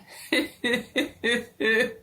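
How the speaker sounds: high, nervous giggle. A narrator's reading. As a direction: laugh as if it is all a joke. Trapped man laughs.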